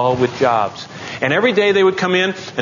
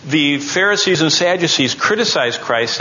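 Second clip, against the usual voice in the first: ordinary talk, nothing marked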